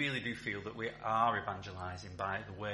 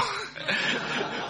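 a burst of breathy laughter at his own story; real amused laugh; 9 dB louder than usual. Real, not acted. Chaplain laughing at his own market story, breathy laugh. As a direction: laugh out loud at the memory.

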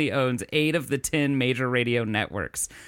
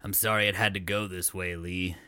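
lower, softer boss farewell; grave boss voice; 7 semitones lower, 6 dB quieter than usual. Acted. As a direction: speak as the somber boss giving bad news.

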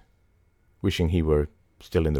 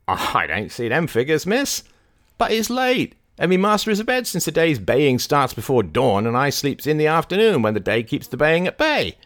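high, loud rustic complaint; high-pitched folksy protest; Barnstable, protesting servant; 9 semitones higher, 3 dB louder than usual. A narrator's reading. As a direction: make excuses like a country servant. Voices Barnstable making excuses.

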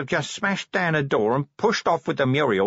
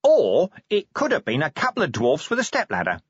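higher, breezy suggestion; cheerful; 6 semitones higher, 4 dB louder than usual. A narrator's reading. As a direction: chip in cheerfully. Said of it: "Nobby went on cheerfully".

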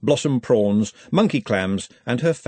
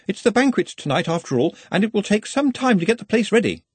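higher-pitched, politely suggesting voice; deferential subordinate; 9 semitones higher than usual. A narrator's reading. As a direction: raise the pitch and address a superior.

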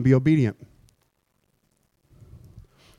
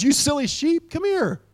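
lightly voiced gentle shepherd, higher; a hint of a patient shepherd scolding; fond shepherd; 8 semitones higher than usual. Shepherd gently scolding the wandering sheep, mildly higher. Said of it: "he goes".

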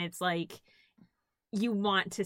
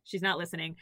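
ordinary talk

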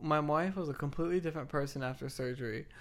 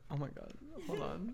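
ordinary talk, nothing marked

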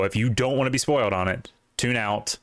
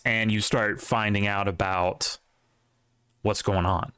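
ordinary talk

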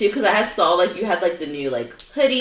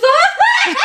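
laughing shriek; 3 dB louder than usual. Retold or imitated. Mom laughing at the singing.

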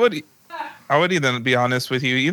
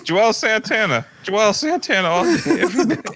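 laughing; 9 semitones higher than usual. Real, not acted. Picks Joel Santana over Ja.

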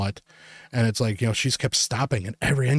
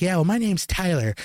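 higher-pitched mock kid voice; boastful kid; 7 semitones higher than usual. Kid introduces himself to the adult, boastful mimicry.

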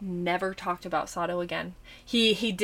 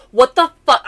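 louder, exasperated swear; irritated outburst; 5 semitones higher, 14 dB louder than usual. Retold or imitated. Annoyed by endless thunder, irritated outburst. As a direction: snap it out at the noise. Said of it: "I was getting mad".

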